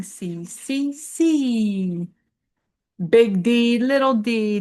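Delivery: lilting Seuss read-aloud; reading to children. Acted. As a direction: read the rhymes to kids, playfully.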